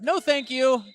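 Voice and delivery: high, loud "no thank you"; sassy refusal. Retold or imitated. Blocker Brass voiced refusing.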